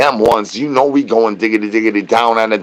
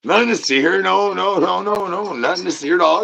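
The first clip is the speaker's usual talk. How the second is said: mock denial; 7 semitones higher than usual.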